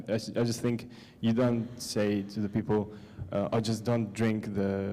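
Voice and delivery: ordinary talk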